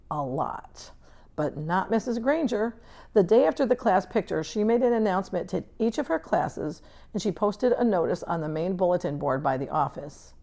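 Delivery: ordinary delivery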